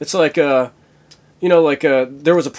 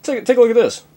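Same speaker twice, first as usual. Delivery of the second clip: higher captain voice; captain's voice; 10 semitones higher, 4 dB louder, faster than usual. Acted. Captain shows Bones the spliff, higher and urgent. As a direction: show it off urgently.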